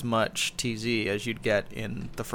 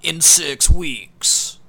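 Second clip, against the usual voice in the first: announcer voice; 5 semitones higher, 9 dB louder, much slower than usual. Retold or imitated. Mocking a TV promo announcement.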